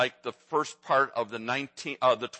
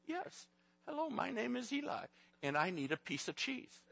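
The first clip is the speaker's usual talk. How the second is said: put-on high, soft little boy voice, very proper; toddler voice; 10 semitones higher, 11 dB quieter than usual. Father imitating his little grandson, high and soft. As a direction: play a tiny boy introducing himself politely. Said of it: "He says".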